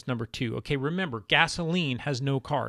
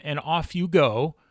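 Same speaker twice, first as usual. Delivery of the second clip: ordinary delivery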